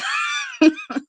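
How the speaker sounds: a sudden high squeal of a laugh. Real, not acted. Flustered by the affidavit questions, a high squealing laugh.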